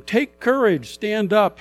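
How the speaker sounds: high, loud encouragement; rally him to get up; urging on. Acted. Crowd urges the blind man.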